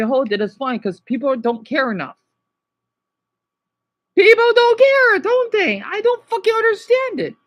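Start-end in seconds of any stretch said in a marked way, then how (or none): 4.1-7.3 high, loud outburst of frustration; real distressed yell; upset shouting. Real, not acted. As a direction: shout it out, pitch way up. Distressed rant boils over.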